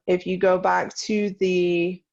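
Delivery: usual speaking voice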